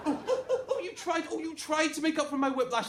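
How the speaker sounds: very high, stammered scoff; mocking outrage. Best man sneering at Angela's amends, high mocking outrage. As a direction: scoff in a high, incredulous squeal.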